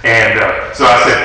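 usual speaking voice

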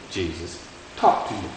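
ordinary delivery

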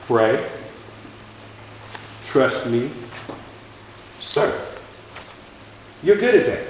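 lightly voiced coach-dad instructions; the Lord. Preacher voicing the Lord giving his job, measured coach-dad firmness. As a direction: hand out orders one by one, with pauses. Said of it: "kind of like a coach slash dad".

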